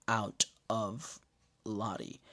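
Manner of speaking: usual speaking voice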